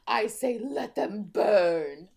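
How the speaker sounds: slow, dramatic defiance; defiant declaration. Acted. Tessa defying the Shadowhunters, slow and dramatic. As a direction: declare it like a rebel heroine. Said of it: "Okay, Katniss, calm down".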